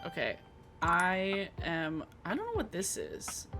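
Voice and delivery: ordinary talk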